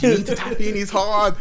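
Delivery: excited quote. Retold or imitated. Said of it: "Callum was like".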